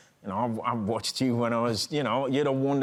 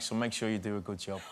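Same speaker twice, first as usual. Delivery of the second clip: lower, softer voice, a hint of a tough older man; imitating Joe Pesci; 5 semitones lower, 6 dB quieter than usual. Actor retells Joe Pesci inviting him to sit, lower and softer. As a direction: drop the voice low and quiet like a mob boss.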